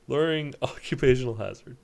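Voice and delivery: loud laugh through the words; loud laughing aside. Real, not acted. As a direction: laugh it out loudly.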